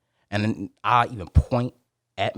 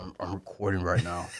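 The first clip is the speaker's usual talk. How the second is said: turned away from the mic; 3 semitones lower, 4 dB quieter than usual. Retold or imitated. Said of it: "throw my mouth over there".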